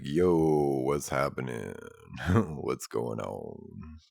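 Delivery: very low, slow, quiet bass rumble; extremely deep bass voice. Shown as a demonstration. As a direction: drop to the deepest voice possible. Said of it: "What's the deepest voice you can do".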